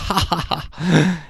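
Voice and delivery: slow, nervous forced ha ha ha; forced laugh. A narrator's reading. Rep laughing awkwardly at Mandy, forced. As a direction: laugh as if at gunpoint. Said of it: "laughed as if somebody had just pointed a gun".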